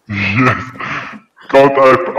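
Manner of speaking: normal talking voice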